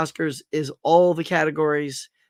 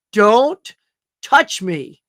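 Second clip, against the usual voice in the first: a sharp louder retold snap; snapped don't touch me; 4 semitones higher, 7 dB louder, much slower than usual. Retelling the star snapping at his handler, louder. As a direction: bark it like an irritated star. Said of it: "Like, just like that".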